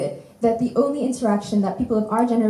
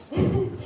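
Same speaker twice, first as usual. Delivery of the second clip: short laugh; 10 semitones higher, 4 dB quieter than usual. Real, not acted. Laughs mid-list of trips.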